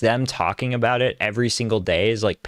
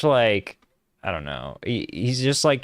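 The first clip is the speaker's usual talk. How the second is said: normal talking voice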